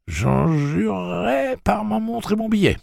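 put-on very high, pleading old man. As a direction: swear it in a frightened plea. Jeeves swearing, frightened.

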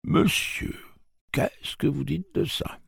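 put-on high, slowish bemused old man; amused old man. Old man amused talking to himself, bemused. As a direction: savour the word with a chuckle.

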